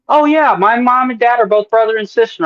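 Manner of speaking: a hint of a breezy guy; mock cheerful Mikey. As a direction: toss it off like it's nothing. Mikey's cheerful reply retold.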